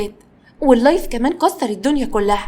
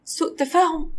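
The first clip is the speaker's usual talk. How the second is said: higher, mocking echo as a question; restrained, derisive questioning; sarcastic echo; 6 semitones higher, 3 dB quieter, slower than usual. A narrator's reading. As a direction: throw it back with scornful disbelief. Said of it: "ابتسمت بتريقة وحاولت تتكلم عادي".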